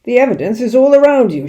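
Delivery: loud, high angry shout. A narrator's reading. Mister Hazel shouting at the father over the pheasants, loud angry shout. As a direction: bark it at him, outraged. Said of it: "shouted Mister Hazel".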